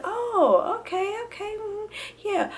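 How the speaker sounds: very high, humoring nurse voice; knowing nurse. Retold or imitated. Second nurse hearing the same answers, knowing. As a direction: imitate a nurse politely going along. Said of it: "gave me that same type of response".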